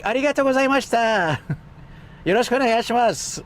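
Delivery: very high, bright-pitched polite Japanese. Retold or imitated. Streamer imitating his old high-pitched Japanese, very high. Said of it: "My Japanese was really high too".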